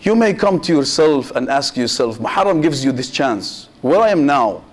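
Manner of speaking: usual speaking voice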